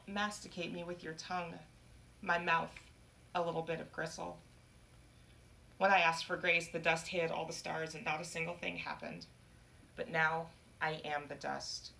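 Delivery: plain reading voice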